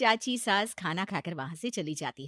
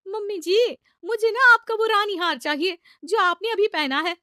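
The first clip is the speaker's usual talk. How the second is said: high, loud, wheedling ask; high coaxing request; 11 semitones higher, 10 dB louder than usual. Acted. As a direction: coax her mother-in-law in a high voice. Bride asks for necklace.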